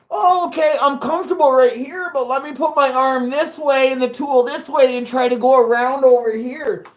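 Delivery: high-pitched parody of a clumsy beginner; mocking a careless worker. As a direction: lampoon someone twisting awkwardly round the tool. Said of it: "we don't wanna be like".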